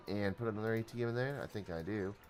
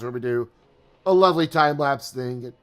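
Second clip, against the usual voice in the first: talking through hiccups; 3 semitones higher, 12 dB louder than usual. Real, not acted. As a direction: hiccup interrupting the words. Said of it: "I have the hiccups".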